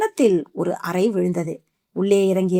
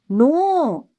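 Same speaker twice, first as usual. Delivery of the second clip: screamed no; 7 semitones higher, much slower than usual. Acted. Aishwarya refusing being called dead, screams no. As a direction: fling out the refusal as a shriek. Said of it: "அலறினாள் Aishwarya".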